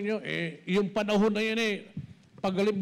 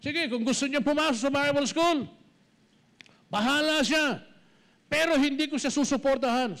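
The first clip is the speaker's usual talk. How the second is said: angry father; 6 semitones higher, 3 dB louder than usual. Retold or imitated. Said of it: "sabi niya, narinig ko".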